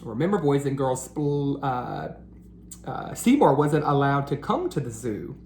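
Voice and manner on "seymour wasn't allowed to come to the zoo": usual speaking voice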